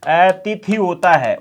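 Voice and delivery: अतिथि said slowly, त first then थ; slow correct form. Shown as a demonstration. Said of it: "अतिथि शब्द". (अतिथि को धीरे, पहले त फिर थ)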